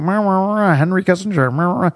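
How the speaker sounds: a hint of a droning tourist; mock babble. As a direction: parody endless political chatter. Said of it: "just going".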